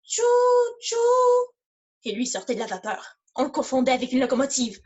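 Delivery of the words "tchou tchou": drawn-out choo-choo noise; imitated train toot. Actor shows the noise the reversing tractor made, imitated toot. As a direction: mimic a steam whistle, twice. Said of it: "il faisait".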